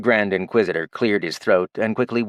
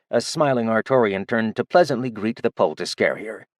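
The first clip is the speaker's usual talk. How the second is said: plain reading voice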